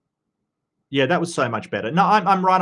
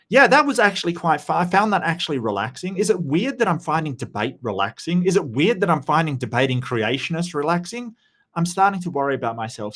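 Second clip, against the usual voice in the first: usual speaking voice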